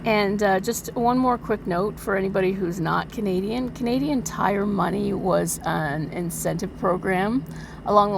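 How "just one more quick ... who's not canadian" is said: ordinary delivery, nothing marked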